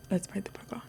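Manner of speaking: ASMR whisper; breathy ASMR whisper into the mic. Pretend.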